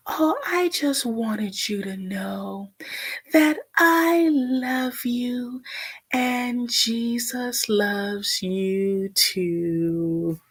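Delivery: high, extremely slow mock-loving drawl; patronizing mother impression. Mother telling daughter she loves her after provoking her, mocked patronizing slow talk. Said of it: "talking slow as shit".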